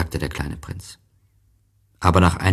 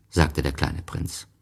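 usual speaking voice

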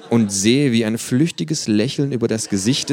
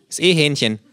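mouth full; 8 semitones higher, 5 dB louder than usual. Acted. Kangaroo character voice.

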